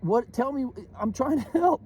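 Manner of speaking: high, fed-up mock reply; mock exasperation. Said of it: "I'm like".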